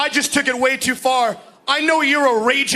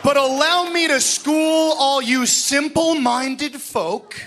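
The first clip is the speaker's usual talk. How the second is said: usual speaking voice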